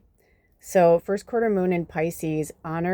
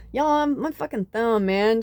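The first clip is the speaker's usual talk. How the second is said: put-on Southern accent; 4 semitones higher than usual. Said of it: "Southern, you know, accent from Tennessee".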